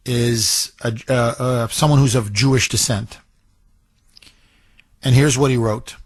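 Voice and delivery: ordinary talk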